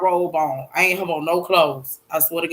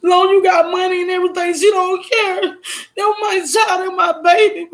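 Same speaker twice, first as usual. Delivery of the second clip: high, sustained tearful wail; crying shout; 10 semitones higher than usual. Real, not acted. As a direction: cry out in real grief and anger. Crying outburst, heard cry and shout.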